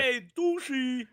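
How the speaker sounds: put-on Brooklyn accent. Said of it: "we use the Brooklyn accent for everyone".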